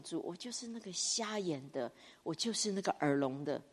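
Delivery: hushed, humble prayer to the Lord; quiet confession. Real, not acted. Preacher confessing softly to the Lord he is blind and deaf.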